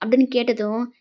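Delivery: ordinary delivery, nothing marked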